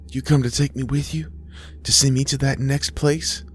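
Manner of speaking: high, tentative questioning voice; wondering daughter. A narrator's reading. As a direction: ask your mother with childlike hope and worry. Daughter asks her dead mother.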